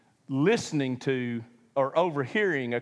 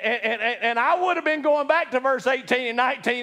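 ordinary talk

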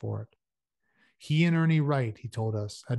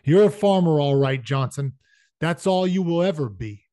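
higher, louder scornful put-down; disgusted contempt; 4 semitones higher, 8 dB louder than usual. A narrator's reading. Shane putting Johnson down before the others, disgusted. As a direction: dismiss them with loud disgust. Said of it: "There was disgust in Shane's voice".

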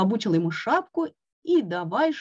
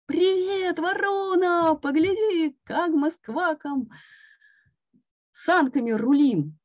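high, bright call; gleeful greeting; 9 semitones higher than usual. A narrator's reading. Toad calling to the crow from the sled, high and gleeful. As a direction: greet the crow, delighted with yourself.

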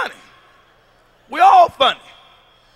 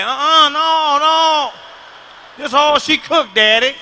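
put-on loud, high child's refusal; a smug kid shutting Daddy down; mock kid refusing; 4 semitones higher, 5 dB louder, slower than usual. Kid refuses father food, loud and high.

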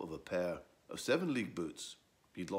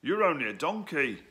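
donkey voice; 7 semitones higher, 9 dB louder than usual. Acted. Donkey retorts.

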